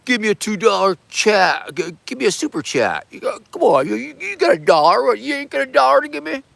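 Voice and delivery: hammed-up street hustler shaking down the chat; mock begging. Streamer hustling his chat for a dollar, mock pushy. Hamming up begging for super chats.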